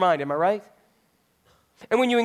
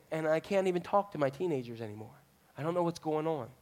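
much softer, lower despair; worried parent; 4 semitones lower, 10 dB quieter than usual. Retold or imitated. Parent who can't talk to teens, softly despairing. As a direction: let it sink, hopeless. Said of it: "they come up to you and they say".

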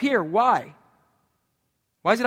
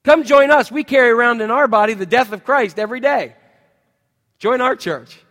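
loud, higher mock church ad; mock sales pitch; 4 semitones higher, 9 dB louder than usual. Preacher mocking a church ad, loud sales pitch. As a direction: sell it like a cheesy ad. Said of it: "a great marketing campaign".

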